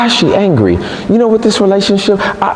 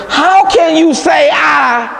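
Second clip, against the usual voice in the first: higher, slow, shouted challenge; Delilah's outrage; 9 semitones higher, slower than usual. Acted. Delilah shouting at Samson, slow and higher. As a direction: throw his word back at him. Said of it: "And she said unto him".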